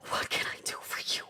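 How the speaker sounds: soft, quick, nearly whispered question; breathy retake. Acted. Monarch just reunited with son, breathy question. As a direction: ask it again on a whispery breath.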